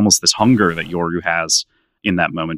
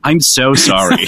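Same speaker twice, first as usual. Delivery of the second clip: laughing apology; 7 semitones higher, 6 dB louder, slower than usual. Real, not acted. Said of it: "struggling to keep my voice at a consistent volume".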